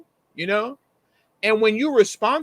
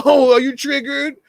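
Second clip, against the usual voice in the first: high, loud sneering taunt voice; hammed-up troll mocking a critic, higher and louder; 7 semitones higher, 6 dB louder than usual. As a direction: play the gloating troll, nasal sneer.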